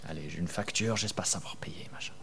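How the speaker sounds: whispered anxious inner thought; worried whisper. Retold or imitated. Believer fretting over a bill, in a whisper. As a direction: whisper it like a private fear about money.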